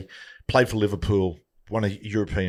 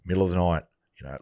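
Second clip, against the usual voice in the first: normal talking voice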